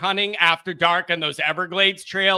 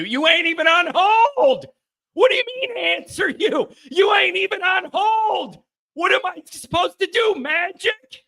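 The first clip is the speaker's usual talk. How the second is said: incredulous shouting; 11 semitones higher than usual. Real, not acted. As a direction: yell it back at the chat like it's absurd. Mocking a viewer who asked for an answer.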